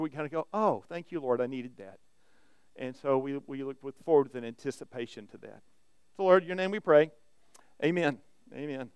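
quiet, earnest prayer voice; hushed pastoral prayer. Acted. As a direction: pray softly to the Lord before the sermon. Pastor prays before the sermon.

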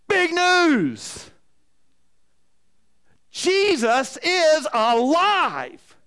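high, loud announcement, last word stretched out; imitated kids' shout. Grandchildren suddenly stop mid-play to announce it, shouting high. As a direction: proclaim it like an excited grandchild. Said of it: "screaming out".

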